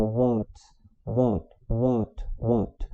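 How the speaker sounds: "what" said as "wot" with a rounded vowel; teacher drilling "what" with a rounded A; A pulled toward O. Shown as a demonstration. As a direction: model "what" slowly, A leaning to O. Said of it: "Então é um A puxado pro O".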